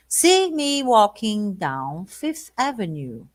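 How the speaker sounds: slow, careful lyric reading. Shown as a demonstration. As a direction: stretch each word slowly. Reads lyric slowly.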